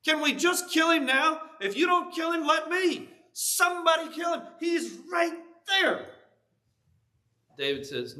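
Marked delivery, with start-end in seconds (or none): 0.0-6.1 much higher, shouted desperate begging; urgent whispered-shout of a soldier close to the sleeping enemy; desperate pleading. Acted. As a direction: beg him to kill the enemy now, voice cracking with urgency. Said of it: "you can just hear the desperation in the man's voice".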